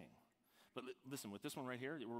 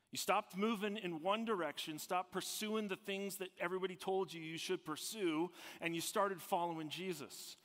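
loud, higher, driving preacher delivery; full-force preaching; 6 semitones higher, 4 dB louder than usual. Real, not acted. Preacher urging listeners on how they started following Jesus, full-force preaching.

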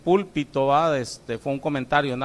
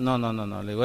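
normal talking voice

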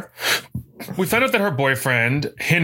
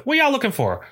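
higher-pitched Southern twang; Southern accent; 11 semitones higher than usual. Retold or imitated. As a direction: let the Southern accent slip out. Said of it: "I love your southern accent".

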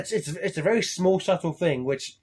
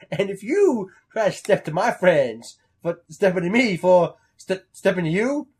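rising, louder confused threat; rambling tough talk; 4 semitones higher, 6 dB louder than usual. Retold or imitated. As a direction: talk yourself into a tangle, louder. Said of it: "April just goes".

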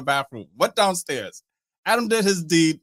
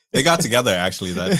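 ordinary talk, nothing marked

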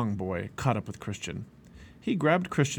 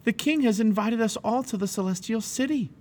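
high-pitched eager announcement; eager invitation; 11 semitones higher than usual. A narrator's reading. Inviting his family.

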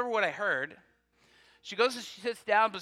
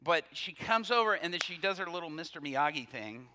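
ordinary delivery

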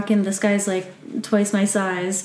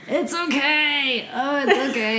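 strained tough guy; 4 semitones higher than usual. Retold or imitated. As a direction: fake being fine through the pain. Trucker insists pain is fine.

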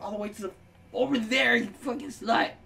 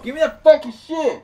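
loud, raised-pitch snap; heated, louder insistence; angry demand; 6 semitones higher, 9 dB louder than usual. Real, not acted. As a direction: demand it with rising irritation. Repeated demand in game quarrel.

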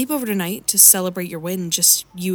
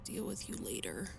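whispering; 18 dB quieter than usual. Acted. Hushing the inner voice.

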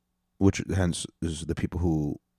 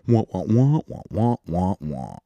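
wordless wah-wah mimicking a droning adult; Charlie Brown adults. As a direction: imitate muffled cartoon grown-up babble. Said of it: "She's fucking Charlie Brown's fucking adult parents".

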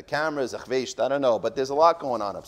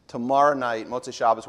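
ordinary talk, nothing marked

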